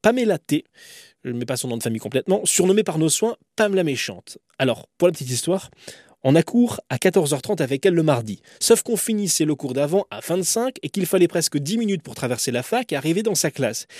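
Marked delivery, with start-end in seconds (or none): none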